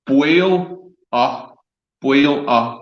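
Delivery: "Boil, uh" split in two, pause before the uh; broken-down pronunciation. Shown as a demonstration. Distiller teaching the host the name, syllable by syllable. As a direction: sound out "Boil" with a w glide, then a separate "uh". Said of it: "that's Boil here".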